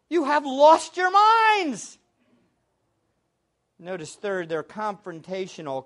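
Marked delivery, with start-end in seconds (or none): 0.1-2.0 higher, louder, slow exclamation; imitated incredulous yell, drawn out; mock outcry. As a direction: cry out in acted disbelief. Acting out the apostles' outcry.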